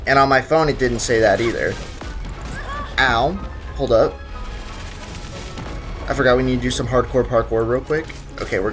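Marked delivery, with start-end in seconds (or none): none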